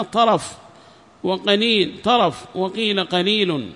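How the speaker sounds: formal lesson reading voice; reading a text aloud. Acted. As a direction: read the passage out clearly to a class.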